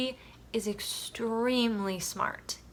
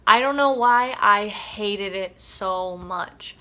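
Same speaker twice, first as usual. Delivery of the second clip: slow, louder exasperated vent; 6 dB louder, slower than usual. Real, not acted. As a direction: drag it out, fed up. Venting about hated narration.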